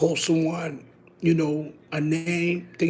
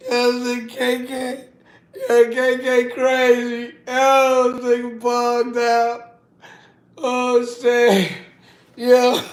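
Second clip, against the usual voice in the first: higher, slow, loud giddy talk; laughing excitement; 7 semitones higher, 4 dB louder, slower than usual. Real, not acted. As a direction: draw it out cracking up.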